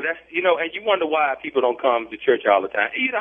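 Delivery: ordinary talk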